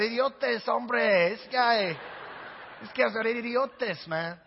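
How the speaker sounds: hammed-up Spanish accent. Preacher joking that it keeps coming out, mock Spanish accent. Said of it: "only say that with a Spanish accent".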